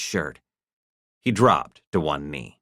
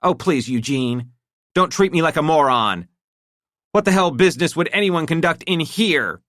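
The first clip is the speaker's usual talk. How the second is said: high, louder angry demand; 11 semitones higher, 5 dB louder than usual. A narrator's reading. Wife demanding an answer, angry and loud. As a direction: insist on an answer now.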